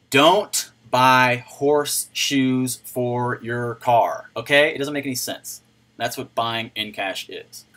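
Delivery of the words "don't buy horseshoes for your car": "don't buy horseshoes for your car" spaced out word by word; slow, emphatic saying. Shown as a demonstration. Streamer drumming a new saying into viewers, slow and emphatic. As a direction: deliver the slogan very slowly, weighting each word.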